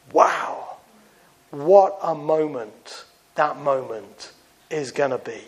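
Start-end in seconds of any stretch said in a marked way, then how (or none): 0.1-0.6 breathy wow. Real, not acted. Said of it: "breathless wow".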